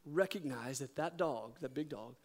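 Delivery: soft, slow inner thought; hushed reflection. Retold or imitated. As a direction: murmur it as a quiet revelation. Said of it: "I thought".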